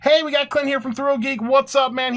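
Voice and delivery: excited greeting. Real, not acted. Hosting, greeting a guest.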